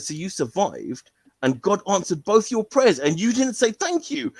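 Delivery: corner him with the point; rising challenge. Retold or imitated. Boy confronting the teacher, building to a high challenge.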